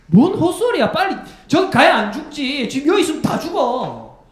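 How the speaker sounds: high, loud frightened yelling; panicked shout. Retold or imitated. Soldier yelling in panic against staying to fight. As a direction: scream it like a terrified soldier.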